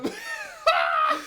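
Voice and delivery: high squeal through laughter; laughing squeal. Real, not acted. Reacting to the burning sauce.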